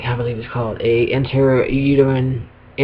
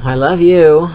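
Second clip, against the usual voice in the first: affectionate call; 4 semitones higher, 7 dB louder, slower than usual. Real, not acted. Calling out to partner.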